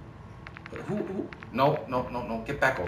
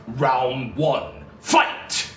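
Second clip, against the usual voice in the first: booming fighting game announcer; Shao Kahn; 2 semitones lower, much slower than usual. Retold or imitated. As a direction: call the round like an arcade announcer. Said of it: "feel like Shao Kahn from Mortal Kombat".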